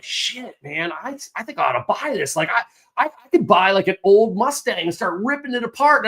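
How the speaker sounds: high, eager self-talk; a gearhead's sudden excitement; excited inner voice. Retold or imitated. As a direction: get giddy about the idea of a project car. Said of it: "And I'm like".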